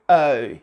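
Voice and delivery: O as a pure, complete British O sound. Shown as a demonstration. Teacher shows the British complete O sound. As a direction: round O fully in British style. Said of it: "They pronounce it this way".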